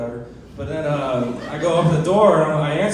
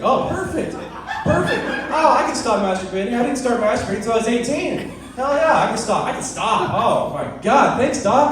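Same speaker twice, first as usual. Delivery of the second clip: sarcastic excitement; 5 semitones higher than usual. Retold or imitated. Answering the doctor's order to stop, in mock glee. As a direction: fake eager agreement, laughing. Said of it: "I was like".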